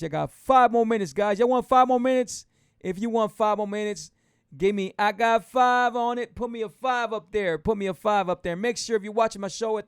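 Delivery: loud, high-energy crowd hype; pumped host calling out for responses; hyped-up rallying. Real, not acted. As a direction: fire up the live audience. Rallying live viewers for five more minutes.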